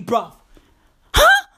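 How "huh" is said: incredulous huh. Acted. Demanding answer from tattooed friend.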